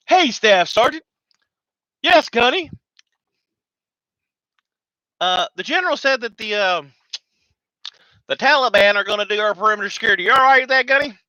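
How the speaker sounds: high, loud soldier banter; acted marines talking. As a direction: play the sergeant and the gunny trading lines. Acted exchange between marines.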